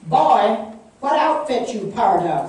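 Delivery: loud, high, harsh barked demand; barking order. A narrator's reading. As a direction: bark it like a commanding officer. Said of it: "their leader barked".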